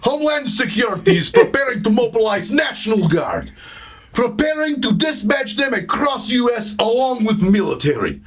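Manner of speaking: mock heavy Russian accent reading a memo; hammed-up Russian accent. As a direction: read it like a Russian spy. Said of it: "I could only read it in this voice".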